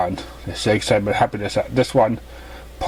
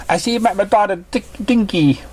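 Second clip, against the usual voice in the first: higher, gentle mother's voice; motherly mare voice; 9 semitones higher than usual. Acted. Mother greeting her daughter's new guest, higher voice. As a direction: play the kind mother pony.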